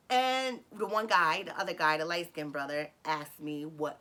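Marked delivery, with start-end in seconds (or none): none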